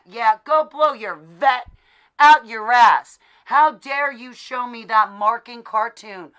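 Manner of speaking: retold rage at the man; furious shout. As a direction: throw him out with a shout. Retold angry confrontation.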